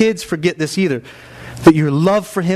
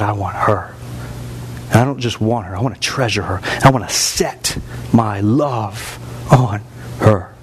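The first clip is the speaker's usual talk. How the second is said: God speaking; 7 semitones lower than usual. Acted. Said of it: "And He said".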